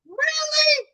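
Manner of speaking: very high, mock-outraged squeak; incredulous. Grandchild answering grandma after false alarm, acted incredulity. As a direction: re-enact exasperated disbelief.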